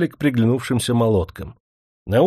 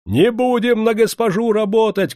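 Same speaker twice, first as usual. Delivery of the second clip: high-pitched defiant cry; raised, protesting yell; crowd shouting; 11 semitones higher, 4 dB louder than usual. A narrator's reading. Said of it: "кричали кругом".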